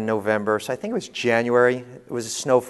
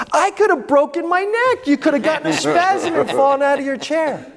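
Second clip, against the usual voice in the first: much higher, squeaky imitation of his daughter; mock daughter; 13 semitones higher, 4 dB louder than usual. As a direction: play the indignant grown daughter scolding dad. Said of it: "and she's like".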